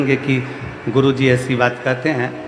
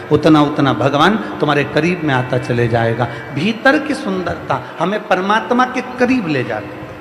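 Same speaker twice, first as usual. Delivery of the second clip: rising, rhythmic sermon delivery; preaching; 3 semitones higher than usual. Acted. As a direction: preach it to the crowd with swelling repetition. Guru preaching to devotees. (प्रवचन की लय में उपदेश)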